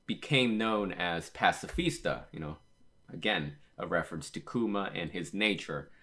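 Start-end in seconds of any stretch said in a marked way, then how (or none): none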